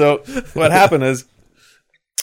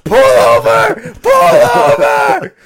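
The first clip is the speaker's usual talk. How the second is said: frantic high yelling, dragged out slow; desperate yell; 7 dB louder, much slower than usual. Retold or imitated. Teammate begging the van driver to pull over, desperate shrieking. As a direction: beg the driver to stop, voice cracking high. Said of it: "like, crying, almost in tears".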